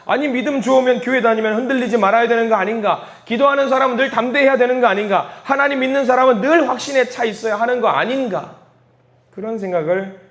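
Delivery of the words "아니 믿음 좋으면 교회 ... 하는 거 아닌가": higher-pitched, insistent rhetorical questioning; challenging worldly skeptic. Acted. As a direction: press each question as a doubter's challenge. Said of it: "세상 사람들이 생각합니다". (따져 묻듯 높은 목소리로)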